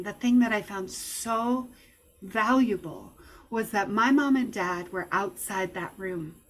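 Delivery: ordinary talk, nothing marked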